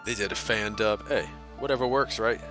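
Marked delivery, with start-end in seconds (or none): none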